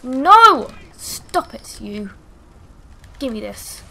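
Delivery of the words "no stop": very loud, higher-pitched cry; dismayed shout. Real, not acted. Player protesting as the fight goes wrong, dismayed shout.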